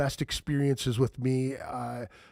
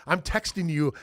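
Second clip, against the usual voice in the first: usual speaking voice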